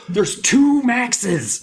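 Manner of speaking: high, loud and drawn-out alarm; slow hammed-up cry of disbelief; mock frantic outburst. April trying to get help, mock frantic.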